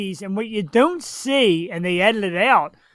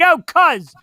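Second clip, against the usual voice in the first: fast, higher tough wiseguy voice, hammed up; tough Italian guy; 8 semitones higher, 4 dB louder than usual. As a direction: play the Jersey Italian tough guy at a drive-thru. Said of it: "act Italian, like, be, like, tough".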